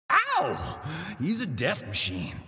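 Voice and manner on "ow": a sudden high yelp of pain. Acted. Reacting to a hit with a high yelp.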